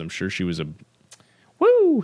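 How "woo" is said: whoop. Real, not acted. Cheering about the fourth woman.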